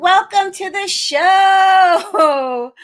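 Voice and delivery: high, loud sing-song hello to the audience; show opener shout. Real, not acted. Host greeting her live audience with a big loud welcome. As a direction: call out a big welcome, stretching the last words.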